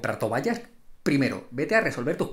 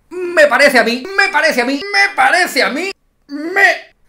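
louder and higher with each repeat; mock outrage building; 9 semitones higher, 8 dB louder than usual. Vlogger ranting about the academy, repeating louder and higher. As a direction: wind up theatrically, each repeat bigger. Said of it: "building mock outrage".

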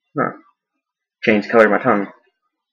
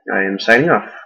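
normal talking voice

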